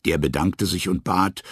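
ordinary delivery, nothing marked

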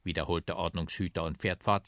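plain reading voice

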